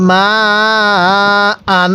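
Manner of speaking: মা held long over four counts, then আন; four-alif stretch. Shown as a demonstration. Teacher showing viewers the long four-alif hold on মা. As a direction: draw the vowel of মা out for four beats. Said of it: "সেখানে চার আলিফ টানতে হয়".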